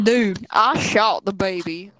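loud, slow mock southern drawl; exaggerated southern-accent imitation; imitated southern accent. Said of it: "the most southern accent I've heard".

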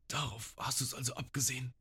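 a dazed cook trembling as he speaks. Retold or imitated. Sanji, still groggy, faces the pirate captain, trembling voice. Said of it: "So mit zitternder Stimme".

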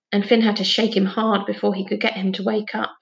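ordinary delivery, nothing marked

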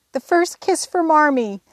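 high, loud happy cry; joyful cry. A narrator's reading. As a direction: call it out with joy. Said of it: "Meg cried".

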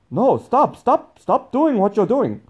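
high, loud, drawn-out order to stop; mock shouting. Imitating Sabine halting the speaker on stage, shouting. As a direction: imitate a bandmate yelling mid-performance.

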